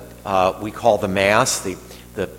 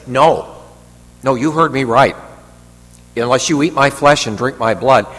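louder, higher, firm refusal to back off; acted firm correction, raised voice; emphatic insistence; 4 semitones higher, 6 dB louder than usual. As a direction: double down on every word.

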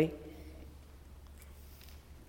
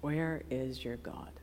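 hushed lament; 9 semitones lower, 14 dB quieter than usual. Acted. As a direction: let it fall almost to nothing.

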